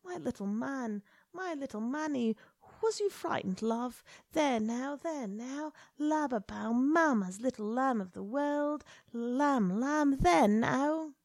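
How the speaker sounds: put-on mother cooing over her sobbing baby; murmuring. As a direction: soothe the child tenderly.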